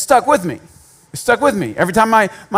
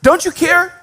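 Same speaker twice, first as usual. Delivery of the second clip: mock accusing question, higher voice; Martha accusing; 10 semitones higher than usual. Martha accusing Jesus, pitch up and rising. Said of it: "She goes right to".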